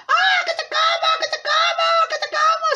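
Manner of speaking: very high, frantic wolf chant; attacking wolf. Acted. Wolf chasing grandmother to eat her, high frantic voice. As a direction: chant it in a high squeal, on the chase.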